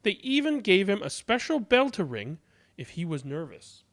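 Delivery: ordinary delivery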